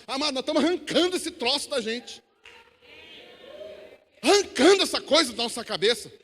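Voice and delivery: fervent pulpit crescendo; full-force preaching. Acted. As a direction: drive it home loudly to the congregation.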